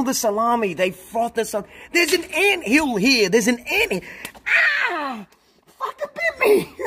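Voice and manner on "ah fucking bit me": high yelp half laughing; startled laughing yelp. Real, not acted. Streamer bitten by an ant, yelping high through a laugh. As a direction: yelp at the sudden bite.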